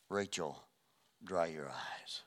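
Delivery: whispered tenderly, slow and soft; hushed comfort. Pretend. God hushing weeping Rachel, whispered and slow. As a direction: breathe it like soothing a grieving mother.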